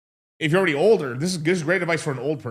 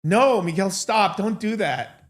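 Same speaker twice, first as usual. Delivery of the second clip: higher, pleading no Miguel stop; mock-pleading with Miguel to stop; 6 semitones higher, slower than usual. Shown as a demonstration. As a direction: beg him to stop, voice raised. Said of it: "I could say something and it can mean".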